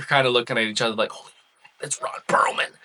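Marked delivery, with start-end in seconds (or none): 1.1-2.7 breathless whisper of disbelief; stunned whisper. Retold or imitated. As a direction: whisper it so the star can't hear. Stunned in elevator with celebrity.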